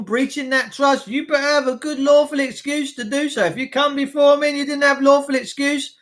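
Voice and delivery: usual speaking voice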